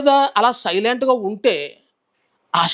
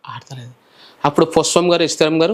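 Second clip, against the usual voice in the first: ordinary delivery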